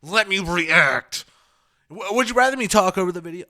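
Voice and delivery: loud annoyed outburst at the comments; getting upset. Real, not acted. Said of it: "I'm getting upset".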